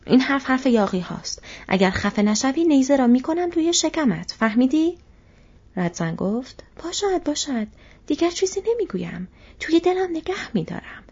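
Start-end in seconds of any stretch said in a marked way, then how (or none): 0.1-5.0 loud, raised-pitch soldier's threat; angry threat. Acted. Soldier threatens tracker in quarrel. 6.8-11.0 high-pitched, grudging placation; grudging backing down. Acted. Tracker backs down after threat.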